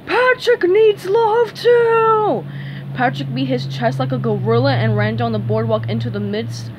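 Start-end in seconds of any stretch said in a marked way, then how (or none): 0.0-2.4 slow, high, loud wail; loud cartoon bellow of hurt; anguished cry. A narrator's reading. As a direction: wail it out, stretching every word. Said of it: "Patrick cried".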